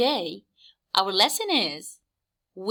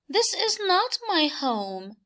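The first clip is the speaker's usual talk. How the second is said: high bug voice with the word this leaned on; high bug voice that lands hardest on this; the bug turning the place down, voice pitched up; little bug voice, THIS stressed; 8 semitones higher than usual. Acted. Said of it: "the bug says".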